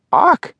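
very high sharp parrot squawk. Acted. Gracie the parrot squawking as she flies off, high squawk.

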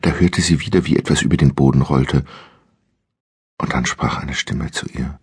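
plain reading voice

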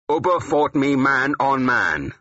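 high, slow, forceful declaration; too loud. A narrator's reading. Warrior claiming his kill before a congregation, too loud. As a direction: raise the voice in rising anger. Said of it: "too loudly now".

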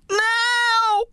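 high, loud scream. A narrator's reading. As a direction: scream the signal. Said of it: "I screamed".

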